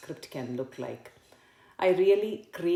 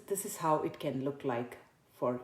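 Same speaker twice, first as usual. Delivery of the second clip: ordinary talk, nothing marked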